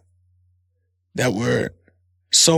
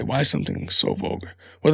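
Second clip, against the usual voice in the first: usual speaking voice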